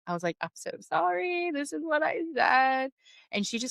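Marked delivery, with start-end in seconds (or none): 0.4-2.9 high, slow, whimpering retelling; mock-sob it high and drawn out; imitated tearful apology. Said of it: "in tears because I thought".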